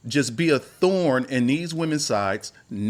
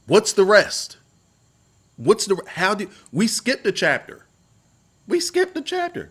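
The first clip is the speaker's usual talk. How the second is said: loud, high-pitched exasperated complaint; 8 semitones higher, 5 dB louder than usual. Real, not acted. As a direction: demand answers, voice jumping up in disbelief. Mock outrage over missing story.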